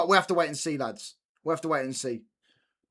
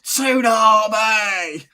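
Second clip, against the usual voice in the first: slow drawn-out mock chant, louder; angry geezer; 5 semitones higher, 7 dB louder, much slower than usual. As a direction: ham up a furious fan stretching the word. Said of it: "you get some angry geezer".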